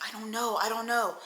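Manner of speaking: soft, repeated worried answer; imitated hushed helplessness. Shaking man answers the women, hushed and helpless. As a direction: answer quietly, as if at a loss.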